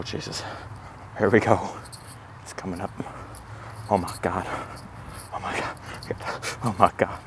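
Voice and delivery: ordinary talk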